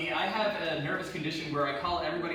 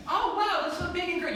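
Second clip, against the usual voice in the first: much higher, gushing praise; mock impressed; 13 semitones higher, 4 dB louder than usual. Worker praising a five-year-old, gushing much higher. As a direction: overdo the wonder for a child. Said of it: "I'm five".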